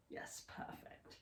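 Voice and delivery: whispered aside, slow. Real, not acted. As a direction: breathe it softly off-mic. Aside checking the camera.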